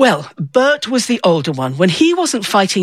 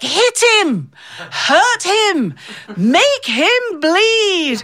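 much higher, drawn-out bloodthirsty heckling; mock yelling old ladies; 10 semitones higher, much slower than usual. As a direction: ham up the ringside grannies. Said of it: "their owners were yelling things like".

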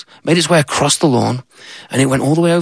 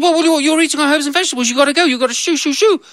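put-on high girl's voice, hurried; nervous child trying to shoo; little girl; faster than usual. Little girl telling the goat to go, high and hurried.

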